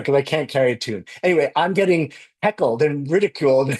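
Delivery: ordinary talk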